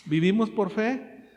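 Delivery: normal talking voice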